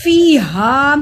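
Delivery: फी हा with both vowels held long; slow, loud recitation of फी हा; lengthened vowels. Shown as a demonstration. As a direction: stretch the ee and the aa evenly. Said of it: "को लंबा किया".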